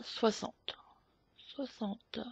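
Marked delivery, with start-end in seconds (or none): 1.4-2.2 much softer echo of the number, barely voiced; murmured to herself. Real, not acted. Host checking the value, murmuring it to herself. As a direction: say it under your breath as you check it.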